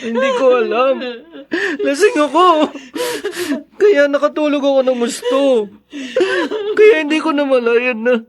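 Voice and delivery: high, crying apology; tearful pleading. Acted. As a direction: beg forgiveness through tears. Drunk father pleads, weeping. (umiiyak na pagmamakaawa)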